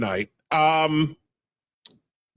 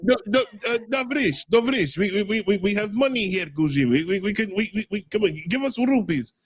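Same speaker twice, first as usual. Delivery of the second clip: hammed-up Russian accent, a bit higher; 4 semitones higher than usual. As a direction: play a joking Russian money man with a Russian accent. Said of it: "and I can speak in a Russian accent".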